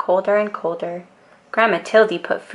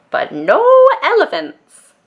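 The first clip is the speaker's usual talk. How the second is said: "no" and "elephants" pitched high and louder; firm no elephants; 12 semitones higher, 6 dB louder than usual. A narrator's reading. Grandma's refrain.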